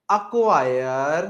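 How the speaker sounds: ordinary delivery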